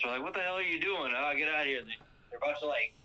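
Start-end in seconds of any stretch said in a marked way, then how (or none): 0.2-1.8 higher-pitched mock outrage; mildly annoyed retelling of an angry bar crowd; pissed off. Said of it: "The bartender's all pissed".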